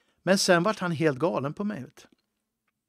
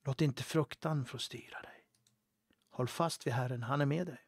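hushed whisper, far softer; whispered comfort; 7 dB quieter than usual. Acted. Preacher whispering comfort to a fearful believer, hushed. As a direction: lean in and whisper it like a secret promise.

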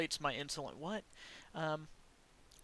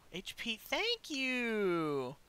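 drawn-out thanks; 6 semitones higher, much slower than usual. Real, not acted. Grateful for health pickup.

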